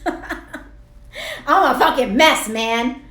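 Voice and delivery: high, loud laugh through the words; laughing at herself. Real, not acted. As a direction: laugh at your own mess. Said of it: "I just laugh at myself when I'm crying".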